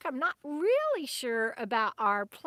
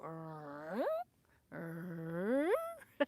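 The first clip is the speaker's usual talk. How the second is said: dog's talking noise; 6 semitones lower, 8 dB quieter than usual. Retold or imitated. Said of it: "He'll go".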